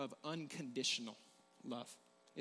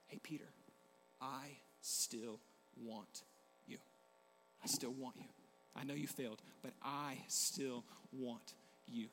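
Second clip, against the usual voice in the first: tender reassurance; 4 semitones lower, 9 dB quieter than usual. Acted. Jesus gently reassures failed Peter.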